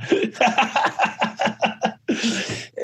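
real laugh. Real, not acted. Storyteller laughs about partying before Phoenix, amused laugh. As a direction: laugh at your own story.

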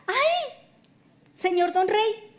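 high, slow, drawn-out falsetto; comic timid-servant imitation, high throughout; put-on eunuch voice. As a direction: tell on someone in a slow, high singsong. Eunuch's voice, acted.

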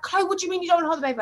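rapid, high-pitched mock disbelief; kicking off. Mother mimics partner challenging her about the baby, kicking off. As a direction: imitate an agitated partner firing off the question. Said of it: "he was kicking off".

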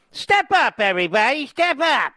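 high, sneering mimicry; mocking barker call.

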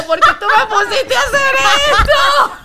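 high, slow, whiny protest to a friend; shrill mock complaint. Retelling her call to Ricardo, high laughing whine. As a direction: play up the outrage, laughing. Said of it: "yo llamé a Ricardo y le dije".

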